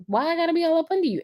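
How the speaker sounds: high, fast, mock-annoyed; mock whiny protest. Wife protesting her husband's closeness, high and whiny. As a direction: squeak it out like a crowded wife. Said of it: "I'm like".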